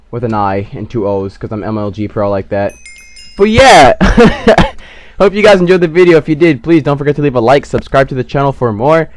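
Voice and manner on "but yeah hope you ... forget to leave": upbeat loud sign-off; youtuber outro. Acted. Youtuber signing off to his viewers, upbeat. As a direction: hype up the goodbye.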